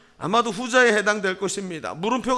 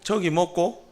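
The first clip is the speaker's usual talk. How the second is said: short question in Gyeongsang accent; Gyeongsang dialect; slower than usual. Shown as a demonstration. Pastor shows dialect for "what is that", Gyeongsang accent. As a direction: ask it with a light Gyeongsang lilt. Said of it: "경상도 말로 사투리로".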